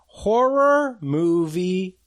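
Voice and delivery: hushed eerie horror-movie whisper, drawn out slowly; spooky whisper. Acted. Host whispering the segment name to listeners, spooky. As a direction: breathe it out like a creepy trailer.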